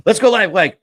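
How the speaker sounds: loud, high burst of excitement; eager self-quote. Retold or imitated. Quotes own eagerness to go live.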